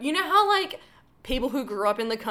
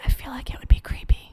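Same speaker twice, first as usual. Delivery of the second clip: breathy whisper, lips at the mic; right in your ear. Acted. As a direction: close whisper into the listener's ear. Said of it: "You're whispering in people's ears right now".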